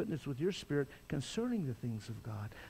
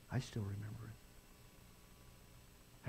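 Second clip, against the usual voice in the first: hushed; 6 semitones lower, 12 dB quieter, much slower than usual. Real, not acted. Quiet memory of his conversion.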